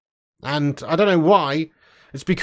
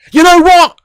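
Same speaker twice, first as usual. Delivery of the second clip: much higher and much louder, playfully defiant; speaking louder; 9 semitones higher, 8 dB louder than usual. Pretend. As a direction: blast it out to tease the viewer who complained. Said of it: "I'll speak louder".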